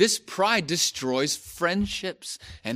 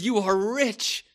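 high-pitched mocking acclaim; sarcastic praise in a raised voice; biting sarcasm; 6 semitones higher, 3 dB louder than usual. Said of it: "He says sarcastically".

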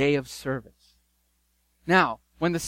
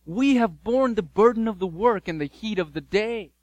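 indignant complaint; 5 semitones higher, 5 dB louder than usual. Acted. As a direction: protest like cheated workers. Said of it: "And they say this".

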